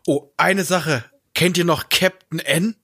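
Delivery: ordinary talk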